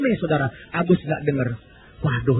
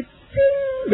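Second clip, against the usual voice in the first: coin ting. Retold or imitated. Coin hitting the floor.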